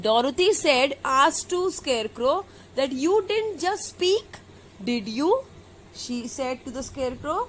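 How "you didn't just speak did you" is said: high opening, dropping into a question; mild wonder. A narrator's reading. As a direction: retell her question with a touch of wonder. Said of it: "asked the girl in wonder".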